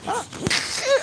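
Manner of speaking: very high squeaky cartoon yelp; squeaky dog voice. Playing the picked-up dog in a squeaky high voice.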